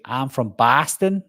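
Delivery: drawn-out mock Boston accent, questioning lift. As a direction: imitate a Bostonian, open "ah" in "Boston". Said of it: "someone from Boston would say".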